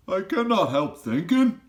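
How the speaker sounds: high, loud, odd giant voice with a rough edge of kindness; BFG giant voice. A narrator's reading. Giant asks Sophie if she is snug, high and loud. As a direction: voice the friendly giant fussing over the girl.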